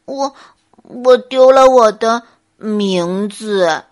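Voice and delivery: slow, halting, loud bear voice; sheepish bear. Acted. Bear admits a loss to the little animals, sheepishly. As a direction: stumble slowly over the words, embarrassed. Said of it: "笨熊不好意思地看了小动物们一眼".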